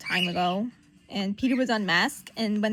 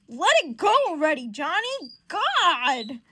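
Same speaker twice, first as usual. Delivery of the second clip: exasperated; 5 semitones higher, 7 dB louder, slower than usual. Acted. Exasperated reply to Johnny.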